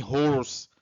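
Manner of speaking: "horse" mispronounced with a closed O, like "hose"; faulty "horse" demo, tight O sound; wrong pronunciation. Shown as a demonstration. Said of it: "nem horse".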